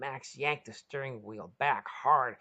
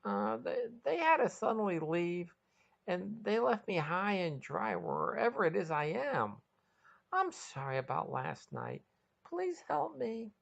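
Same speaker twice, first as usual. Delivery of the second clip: high, hesitant pleading voice; nervous; 7 semitones higher than usual. A narrator's reading. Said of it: "nervously told her".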